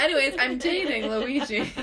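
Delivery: high laughing mock announcement; mimicking friend. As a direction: tease her in a silly voice. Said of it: "she's like".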